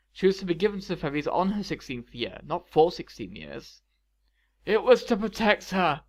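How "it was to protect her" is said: raised, louder, drawn-out protest. A narrator's reading. King Stefan defends himself to Maleficent, protesting. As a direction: push back insistently, pitched up. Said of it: "King Stefan protested".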